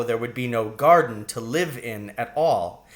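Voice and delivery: plain reading voice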